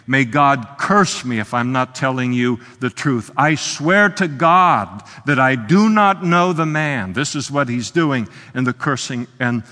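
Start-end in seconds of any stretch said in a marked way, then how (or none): 0.1-7.1 loud, emphatic oath, slowing as it builds; swearing an oath. Acted. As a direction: call down a curse on yourself, rising in force. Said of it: "he's calling down and saying".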